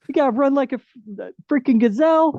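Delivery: high, loud pep-talk push; mock coach barking at a runner; excited coaching.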